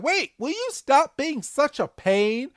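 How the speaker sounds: high-pitched mock complaint; exasperated cartoon villains. Podcaster voicing Team Rocket at toy-throwing Groki, high exasperation. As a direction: whine it up high, fed up.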